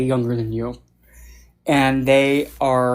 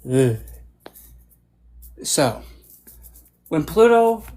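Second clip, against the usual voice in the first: ordinary talk, nothing marked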